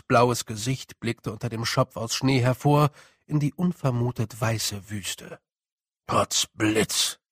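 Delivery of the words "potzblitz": a low, grumbling little blue man; gruff grumble. Acted. A tiny blue man grumbles at the snow. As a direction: grumble it under your breath. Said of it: "brummte es".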